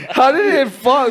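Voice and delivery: loud high laugh into a question; cracking up while asking. Real, not acted. Laughing at bottle mishap.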